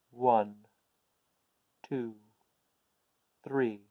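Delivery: slow, grave count to three; solemn counting. A narrator's reading. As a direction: count like a serious ritual. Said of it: "in a solemn voice".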